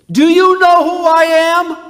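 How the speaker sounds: very loud, high and slow, every word hammered out; the son yelling his identity at his date; shouted question. Retold or imitated. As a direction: bellow it out as a dramatic reveal. Son reveals himself to girlfriend.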